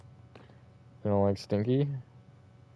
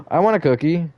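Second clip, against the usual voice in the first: eager gasp; 8 semitones higher, 5 dB louder than usual. Real, not acted. Gasps at cookies.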